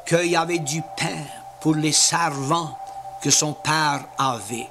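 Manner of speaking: usual speaking voice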